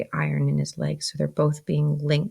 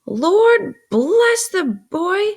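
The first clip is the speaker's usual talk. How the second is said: loud, high, very drawn-out outburst; exasperated exclamation; 8 dB louder, much slower than usual. A narrator's reading. Sister exclaims at Pip's question, exasperated. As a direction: stretch the words in exasperation. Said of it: "exclaimed my sister".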